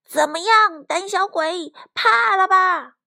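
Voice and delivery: high, loud cocky jeering; rally the gang against a coward; taunting battle cry. Acted. Roosters taunt and chase the weasel. (得意地高声叫阵)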